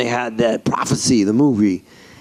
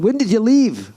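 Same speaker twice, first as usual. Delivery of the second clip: higher Glaswegian lilt; Scottish accent; 6 semitones higher than usual. Retold or imitated. Glasgow singer asking a supposed fellow Scot, Scottish accent. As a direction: ask it like a Glaswegian. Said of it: "And he's going".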